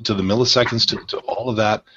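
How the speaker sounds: ordinary delivery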